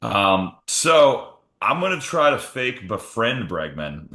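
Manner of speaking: normal talking voice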